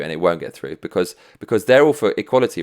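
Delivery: ordinary delivery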